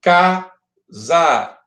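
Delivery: "casa" broken into two slow, long beats, ca... za; separado em sílabas. Shown as a demonstration. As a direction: split "casa" syllable by syllable with a gap. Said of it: "você vai separar a sílaba casa". ("casa" pausadamente, sílaba por sílaba)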